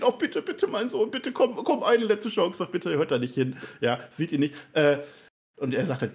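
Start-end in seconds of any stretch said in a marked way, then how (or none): 0.0-3.6 much higher, pleading imitation of a desperate dad; mock begging father. Host imitating Ray begging his son Dominik, high whiny plea.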